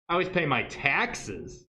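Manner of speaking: slow, with "taxes" stretched out at the end; stress on TAXES. Shown as a demonstration. Teacher showing emphasis changes meaning, stress on "taxes". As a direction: lean hard on the word "taxes".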